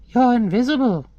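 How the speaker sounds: high-pitched talking bird. Acted. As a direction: tell him in a little bird's high voice. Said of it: "the bird tells him".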